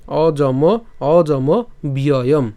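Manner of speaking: slow অজম, then drawn-out ব্যয়ম; pronunciation demo. Shown as a demonstration. Teacher repeats the word for students, slow অজম ব্যয়ম. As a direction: stretch অজম and begin ব্যয়ম on a clear b. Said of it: "একসাথে যদি বলি".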